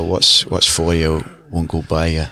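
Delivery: slow Scottish lilt on the saying; a mum's Scottish saying, said slowly; Scottish accent. Retold or imitated. Asked for the Scottish accent, quoting his mum in a Scottish accent. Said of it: "Can you do that in a Scottish accent?".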